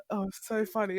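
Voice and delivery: ordinary talk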